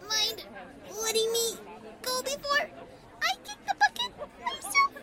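high, strangled begging; gasping plea. A narrator's reading. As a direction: beg while being squeezed breathless. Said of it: "begged Rainbow Dash. Releasing the gasping Pegasus".

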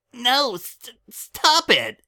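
high squeal while being tickled; giggly shriek. Acted. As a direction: squirm and shriek through giggles.

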